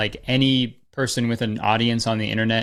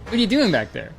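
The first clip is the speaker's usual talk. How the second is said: high, eager fan voice; excited fan customer; 8 semitones higher than usual. Retold or imitated. Starbucks customer recognizing the Viner, high and eager. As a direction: gush like a fan who spotted him. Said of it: "they'd be like".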